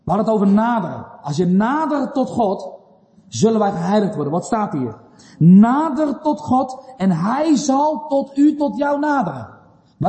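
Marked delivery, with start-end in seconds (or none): 5.4-9.5 high, slow, drawn-out proclamation; emphatic preaching. Real, not acted. Preacher proclaiming God's promise to the congregation, emphatic preaching. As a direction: stretch out the verse as a ringing promise.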